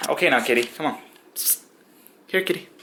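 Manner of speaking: high coaxing kitty call; calling a pet. Real, not acted. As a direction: sweetly coax the cat up. Coaxing cat to stand.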